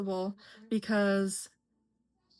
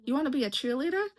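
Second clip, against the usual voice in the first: quick, higher-pitched doubting question; imitated incredulous response; 5 semitones higher, much faster than usual. People responding to a shy kid's dream, mock incredulity. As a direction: mimic someone doubting a child's dream, fast and high. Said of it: "I would get responses like that".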